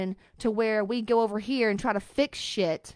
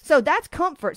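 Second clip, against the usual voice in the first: emphatic rant; 5 semitones higher, 7 dB louder than usual. Real, not acted. Ranting at comfort-seeking clients.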